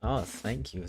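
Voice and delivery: ordinary talk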